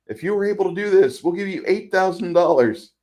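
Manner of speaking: lightly voiced pitchman, high and loud; pitchman's offer. Film boss tempting drivers with money, mock sales pitch. Voicing the film's escalating cash offer.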